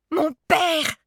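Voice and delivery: high, loud cry; cried out. A narrator's reading. Child crying out to mother about father, loud. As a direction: cry it out at full pitch. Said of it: "criai-je".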